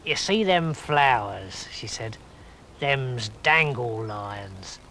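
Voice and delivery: low, slow know-it-all toad; old toad voice. Acted. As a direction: drawl it like a smug old toad. Mary the toad voice.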